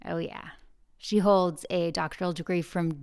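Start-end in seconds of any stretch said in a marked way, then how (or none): none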